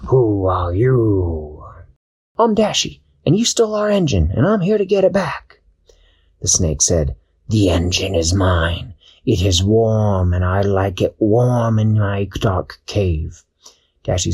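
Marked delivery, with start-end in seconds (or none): 0.1-1.6 very slow, drawn-out menacing question; sea snake. Acted. Snake challenging Dashi in the dark, slow and menacing. 2.4-5.6 much higher, quick, determined voice; bold Dashi. Acted. Dashi confronting the snake, high and determined. 7.5-13.4 greedy snake. Acted. Snake refusing to give back the engine, slow and possessive.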